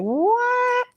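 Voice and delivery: a sudden high squeak of disbelief; surprised. Retold or imitated. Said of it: "I was like".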